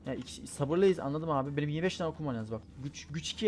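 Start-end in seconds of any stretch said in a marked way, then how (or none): none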